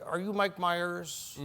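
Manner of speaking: slow, soft producer impression; understated imitation of a measured phone caller; Lorne Michaels. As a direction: ask it slowly and quietly, deadpan. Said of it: "Lorne Michaels called and said".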